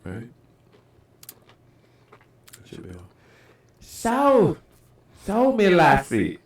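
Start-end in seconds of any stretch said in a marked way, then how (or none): 3.8-6.4 loud, high-pitched mock Scottish; exaggerated Scottish burst, pitched way up; Scottish accent. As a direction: launch into a hammed-up Scottish accent. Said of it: "No, that's Scottish".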